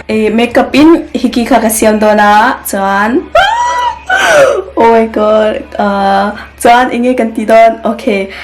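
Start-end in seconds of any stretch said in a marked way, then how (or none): 3.2-4.8 very high shrieking laughter. Real, not acted. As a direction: let out a squealing laugh. Laughing hard about the fake hickey.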